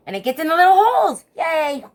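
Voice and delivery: high, loud and fast; excited. Real, not acted. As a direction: rushes out in joy. Excited about the method.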